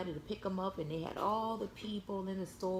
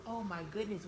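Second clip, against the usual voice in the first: plain reading voice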